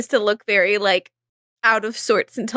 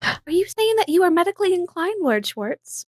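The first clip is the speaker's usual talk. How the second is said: higher, coy flirting voice; teasing Izzy; 7 semitones higher than usual. Acted. Izzy to Lord Schwartz, teasing about medicine, higher coy voice. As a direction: tease him playfully.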